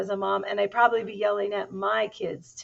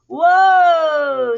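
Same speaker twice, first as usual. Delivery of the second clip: long, loud, high whoa, sliding down; startled whoa; 9 semitones higher, 18 dB louder than usual. A narrator's reading. Kitten flying back into the shack, startled whoa. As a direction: wail it as if yanked backwards. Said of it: "said Scapito as he flew back".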